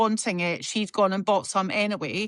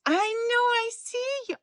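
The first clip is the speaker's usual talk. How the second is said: much higher, cooing pet voice; high cooing voice for a beloved pet; 13 semitones higher than usual. Real, not acted. Baby-talking to the dog.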